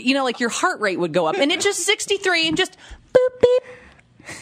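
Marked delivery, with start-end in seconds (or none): 3.1-4.4 high-pitched electronic boops and beeps; imitated monitor beeps. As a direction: mimic a steady heart monitor.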